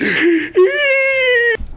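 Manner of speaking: high shrieking gasp, far from his own voice; a cartoon monster gasping at fresh blood; shrill gasp. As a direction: gasp like a starving severed head. Head reacts to blood squirt.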